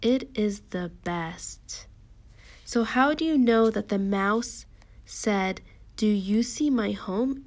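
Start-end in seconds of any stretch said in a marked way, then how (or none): 0.0-1.9 slow, deliberate and slightly subdued; measured teacher reading; slow read-along. Shown as a demonstration. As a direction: read it slowly, word by word.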